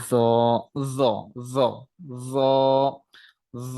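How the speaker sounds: repeated জ demo; জ, crisp and repeated; letter ja. Shown as a demonstration. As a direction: say জ clearly each time. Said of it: "এটি হচ্ছে".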